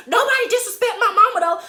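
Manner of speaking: high, loud, quick warning; retold heated shout; yelling. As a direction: yell it at him in defense of your mama. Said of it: "Like, I'm yelling at him".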